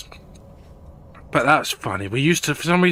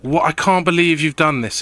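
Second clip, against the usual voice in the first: mock upset friend, a bit louder and higher; hammed-up friend's outrage; salty protest; 5 semitones higher, 4 dB louder than usual. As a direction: imitate a friend's salty complaint. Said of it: "he gets so salty".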